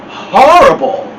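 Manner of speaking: usual speaking voice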